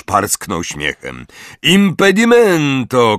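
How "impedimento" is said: high, loud, drawn-out incantation; shouted spell. A narrator's reading. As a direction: cry out the spell. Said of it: "krzyknął".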